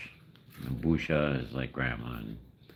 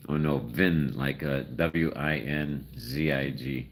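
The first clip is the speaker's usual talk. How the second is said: ordinary talk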